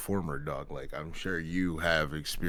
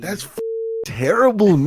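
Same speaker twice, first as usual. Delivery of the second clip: sudden high yelled terrible; disgusted shout; 10 dB louder than usual. Real, not acted. Friend reacting to sweaty Ren Fair crowds, shouting in disgust. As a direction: blurt it out loud in disgust.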